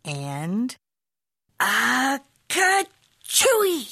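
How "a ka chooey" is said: a big slow comic sneeze. A narrator's reading. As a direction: drag the sneeze out, cartoon style. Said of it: "The kitty boy sneezed".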